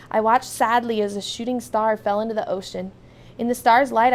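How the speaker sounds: ordinary delivery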